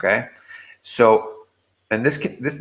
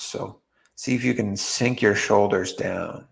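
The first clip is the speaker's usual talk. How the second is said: guided meditation; 5 dB quieter than usual. Real, not acted. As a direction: guide them gently into relaxing, with long pauses.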